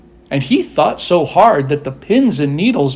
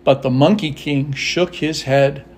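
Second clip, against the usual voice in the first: ordinary delivery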